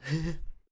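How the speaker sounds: quick amused chuckle; a brief real laugh; short laugh. Real, not acted. Laughs at own yawn.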